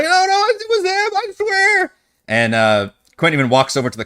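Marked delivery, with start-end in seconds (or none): 0.0-1.9 crying plea. Acted. Wumpus dragged off by guard.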